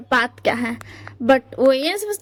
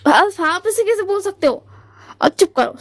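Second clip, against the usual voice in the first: higher and louder, cutting the doctor off; angry retort; 6 semitones higher, 4 dB louder than usual. Retold or imitated. Wing outraged at the doctor's calm words, raised indignant retort. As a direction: snap back in outrage.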